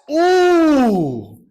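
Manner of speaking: high loud mocking ooh; whole class goes ooh; a classroom's loud high ooh. Class reacts to comeback.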